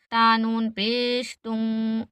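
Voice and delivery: ordinary delivery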